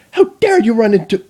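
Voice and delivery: high, loud indignant outburst; indignant queen. Acted. Chrysalis snapping at the strange machine, high and indignant. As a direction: snap at whatever bumped you. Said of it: "Chrysalis began".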